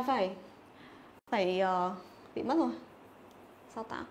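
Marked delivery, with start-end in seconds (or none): none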